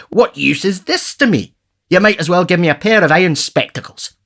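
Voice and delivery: high whining beggar voice; ungrateful vagrant. Retold or imitated. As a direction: play the villain pauper complaining. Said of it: "says the ungrateful villain".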